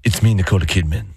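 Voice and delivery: hushed, low and airy impression; imitating a movie star's intimate greeting; Nicole Kidman. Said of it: "Nicole Kidman right in the beginning is like".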